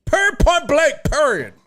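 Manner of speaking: high-pitched sassy imitation; mocking voice. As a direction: parody the annoying people who say it. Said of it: "I hate people that say that shit".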